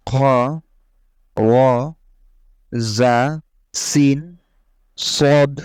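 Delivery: each letter ফা, রাল, র, হা, খ, জা, সিন, সদ, দত, ত, আইন, কফ read slowly and clearly in Arabic sounds with pauses between; slow paced Arabic alphabet recitation for learners; Arabic letter drill. Shown as a demonstration. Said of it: "হরফগুলো প্রথমে পড়ে নেই".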